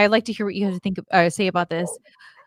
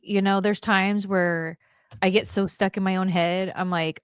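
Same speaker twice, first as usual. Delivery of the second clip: ordinary delivery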